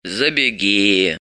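low, slow creak; drawn-out, creaking low voice; creaky old woman. A narrator's reading. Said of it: "проскрипела старуха".

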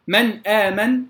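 من آمن with a still short noon, then hamza; clear izhar. Shown as a demonstration. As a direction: keep the noon of من crisp before آمن. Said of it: "أيضًا لا أقول". (إظهار نون من آمن)

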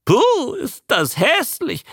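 high, slow creature squawk; high-pitched animal mimic; talking newt. A narrator's reading. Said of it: "sagte der Molch plötzlich".

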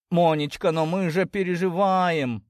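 high, drawn-out pleading; insistent father. A narrator's reading. Father refusing to let up on daughter, pleading. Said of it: "не унимался отец семейства".

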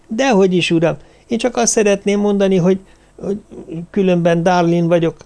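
high, hesitant little-woman voice; polite little lady. A narrator's reading. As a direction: speak up shyly in a small high voice. (udvarias, vékony hangú kis hölgy)

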